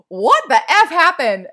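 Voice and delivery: loud, high, shocked outburst; exasperated yell. Retold or imitated. Calling her friend Melissa after the news, a loud shocked yell. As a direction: blurt it out loud and high in disbelief. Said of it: "and I'm like".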